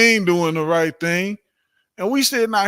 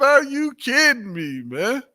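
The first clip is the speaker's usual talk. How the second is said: higher, slow retold "are you kidding me"; retold disbelief; 6 semitones higher, 3 dB louder, slower than usual. Retelling his reaction to Farrakhan, mock disbelief. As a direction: replay the shock, drawn out. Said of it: "I said".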